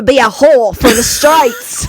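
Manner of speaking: loud, high put-on American voice; American accent. Showing off accents to a friend, a loud American voice. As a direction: belt it like a brash American. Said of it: "That's pretty good".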